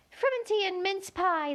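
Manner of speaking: high, thin little insect voice; a tiny gnat piping up to Alice. A narrator's reading. Gnat telling Alice where to look, high and thin.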